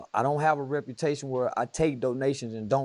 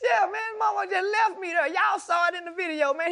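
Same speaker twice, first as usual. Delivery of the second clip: very high, hammed-up protest; mock whining complaint. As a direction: complain in a squeaky, wronged voice. Acts out his complaint at being left.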